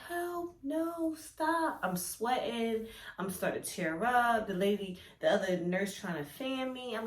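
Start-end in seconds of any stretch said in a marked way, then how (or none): 0.1-1.8 high-pitched panicked cry for help; retold scream. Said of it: "and I'm screaming like".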